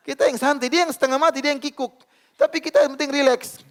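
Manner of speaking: amused preacher laughing at the irritated neighbour. Acted. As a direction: chuckle as you say it, voice up.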